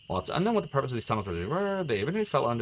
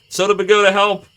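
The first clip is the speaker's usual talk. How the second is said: loud, high laughing plea; real flustered cry for help; panicked call for help; 10 semitones higher, 13 dB louder than usual. Real, not acted. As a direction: shout for the mod in a laughing panic. Calling moderator for help in panic.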